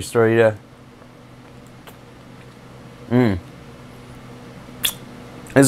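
talking around candy; candy at lips; sucking a lollipop. Real, not acted. Seen 1: Lollipop in mouth early and at +5–7.8; out by +8.5.

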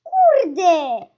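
loud cry, falling at the end; frustrated outburst. Real, not acted. Player just missed her prey, frustrated outburst. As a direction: burst out loudly in frustration.

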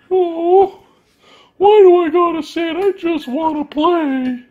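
high, slow whining pup voice; whiny dog voice. Pretend. Voicing the dog's reply.

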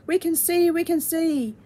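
joyful cry. A narrator's reading. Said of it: "they blinked".

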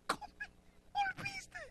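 tiny, very high, hushed voice; stunned disbelief. Acted. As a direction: barely get it out on seeing a lost love. Oseas ve volver a su amor. (asombro con un hilo de voz agudo)

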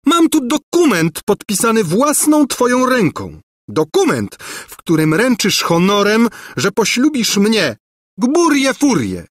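high, indignant tirade; outraged governess. A narrator's reading. Governess waving his signed promise, outraged. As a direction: insist on it in a high, furious voice. Spurned governess threatens the prince.

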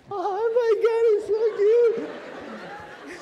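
loud, stretched falsetto squeal; gushing puppy owner. Acted. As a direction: squeal at a puppy, wildly overdone. New owner gushing over puppy.

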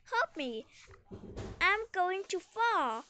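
storybook cry for help, lightly acted; mild cry for help. A narrator's reading. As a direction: call out in mild alarm, pitch lifting. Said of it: "he cried".

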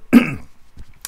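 clears throat sharply; a quick rough throat clear. Real, not acted. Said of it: "if it sounds like I've got a frog".

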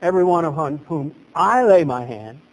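ordinary delivery

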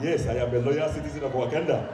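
put-on loud, stately Wakandan accent; proud African accent. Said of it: "I am now proud of my African accent".

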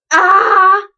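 loud high squeal of delight; excited squeal. Real, not acted. Fan squealing at her idol dancing. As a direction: squeal at the screen.

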